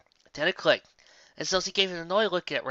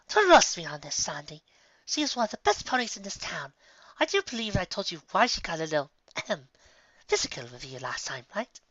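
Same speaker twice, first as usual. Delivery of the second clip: higher, coaxing lady voice; smooth her friend's nerves, higher; Rarity, friendly; 5 semitones higher than usual. Acted. Rarity calming Sandalwood about Applejack, higher and coaxing. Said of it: "Rarity asserted".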